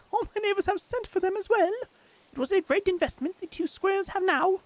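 British lady accent played for laughs, high and loud; British female accent. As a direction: read the letter as a posh British lady, hammed up. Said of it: "this would be like a British accent then".

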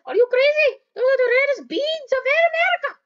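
high, loud squeaky outburst; Mickey Mouse. Retold or imitated. Mickey scolding over spilled beans, high mock outrage. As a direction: yell it in a high cartoon voice.

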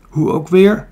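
loud rallying yell. A narrator's reading. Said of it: "nog harder schreeuwend".

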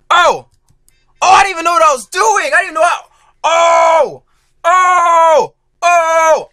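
high loud panicked cries; panicked yelling. Real, not acted. Gamer yelling as he loses control. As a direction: shout in real panic.

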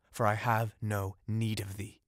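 plain reading voice

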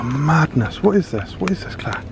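usual speaking voice

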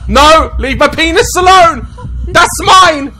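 high, loud panicked yelling; panicked shout. Real, not acted. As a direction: shriek it in real alarm. Screaming at attacker in game.

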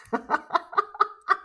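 high-pitched run of laughter. Real, not acted. Speaker after granting her audience permission, high laugh. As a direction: laugh it out high in quick bursts.

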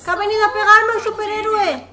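high, loud incredulous cry; shocked that a hero attacks; surprised. Real, not acted.

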